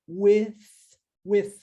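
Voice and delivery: "with" ending in a voiceless th, tongue between teeth. Shown as a demonstration. Teacher demonstrating the unvoiced TH in "with". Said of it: "T H no finalzinho, não vozeado".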